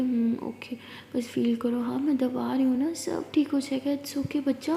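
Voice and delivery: slow hushed comfort, half whispered; calm her like a hurting child; tender whisper for a sick partner; soft soothing. Acted.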